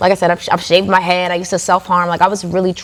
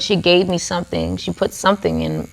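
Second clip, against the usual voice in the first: ordinary delivery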